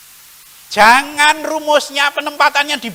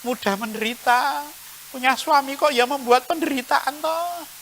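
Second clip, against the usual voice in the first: soft, whiny lament about a hard life; a quiet, sorry-for-yourself grumble; self-pitying complaint; 9 dB quieter than usual. Retold or imitated. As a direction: moan quietly about your bad luck. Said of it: "Jangan mengatakan".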